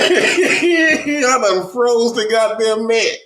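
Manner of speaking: words squeezed through laughter; cracking up mid-sentence; laughing while talking. Real, not acted. Laughing at own joke.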